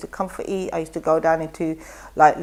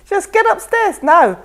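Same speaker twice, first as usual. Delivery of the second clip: bossy friend; 12 semitones higher, 6 dB louder, slower than usual. Retold or imitated. Friend ordering her to the gym.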